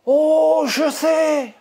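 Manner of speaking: eurêka soudain, voix plus aiguë et forte. Real, not acted. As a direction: lancer l'idée avec éclat. L'idée lui vient soudain. (exclamation ravie, plus aiguë et plus forte)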